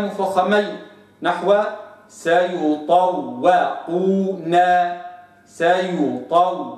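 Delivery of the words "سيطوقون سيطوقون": سيطوقون slow with the waw kept thin between two heavy letters; thin waw demo. Shown as a demonstration. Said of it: "هكذا".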